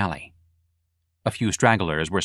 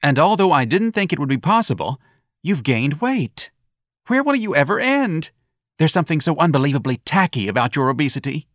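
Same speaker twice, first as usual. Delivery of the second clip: put-on campy young man; 7 semitones higher than usual. Young man mocking the earring, high and campy.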